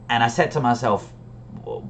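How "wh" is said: mock-baffled, soft self-question; retold quiet bewilderment; puzzled. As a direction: softly murmur it to yourself, puzzled. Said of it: "I was really confused".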